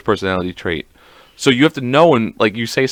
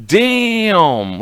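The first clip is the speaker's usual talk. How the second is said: high, drawn-out hammed-up shock; mock stunned exclamation; 10 semitones higher than usual. Partner re-enacts being ordered off the couch, mock stunned. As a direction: let it slide down from way up high.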